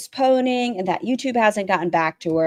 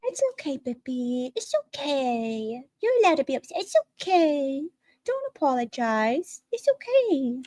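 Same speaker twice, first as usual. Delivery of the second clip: put-on higher and softer, babying coo; mock soothing; 5 semitones higher, 4 dB quieter than usual. Mocking a parent comforting a crying streamer, high soft coo. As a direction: coo at her like an upset toddler.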